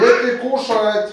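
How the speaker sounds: slow loud call down the hall. Real, not acted. Father calls kids to eat.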